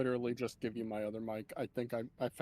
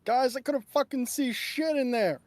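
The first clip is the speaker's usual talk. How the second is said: higher, loud frustrated yell; 10 semitones higher, 7 dB louder than usual. Acted. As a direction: shout it out to the party. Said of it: "come out of the water and yell".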